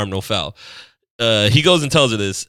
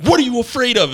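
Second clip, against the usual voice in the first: belligerent customer; 10 semitones higher than usual. Retold or imitated. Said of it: "she starts getting belligerent".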